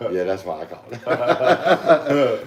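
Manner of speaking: slow, chuckling through the words; amused reveal, slow and giggly; laughing reply. Retold or imitated. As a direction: laugh as you say it. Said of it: "She goes".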